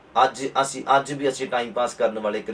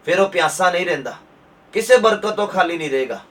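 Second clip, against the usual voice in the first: building to a loud, higher proclamation; Jesus's promise proclaimed; 4 semitones higher, 5 dB louder than usual. Acted. Preacher voices Jesus's promise to the congregation, building loud proclamation. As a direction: thunder out the promise, slowing at the peak. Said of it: "ਕਹਿੰਦੇ".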